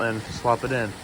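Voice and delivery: ordinary talk, nothing marked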